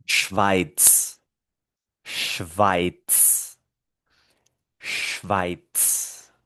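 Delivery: "Schweiz" slowly, ending in ts: shvaits; final Z as TS. Shown as a demonstration. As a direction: model "Schweiz" low, each time alone. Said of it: "Esto se pronuncia".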